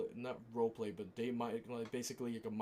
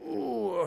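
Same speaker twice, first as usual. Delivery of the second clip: stretching groan; 9 semitones higher, 6 dB louder than usual. Real, not acted. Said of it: "I'm just stretching real quick".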